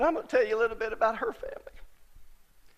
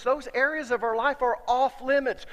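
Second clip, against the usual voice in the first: ordinary delivery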